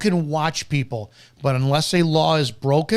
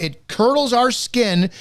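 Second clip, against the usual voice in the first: normal talking voice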